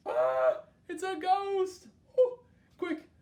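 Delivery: high, loud hammed-up cry at a ghost; playful storytime panic; mock fright. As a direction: pretend to be scared for the children. Ghost appears in picture book.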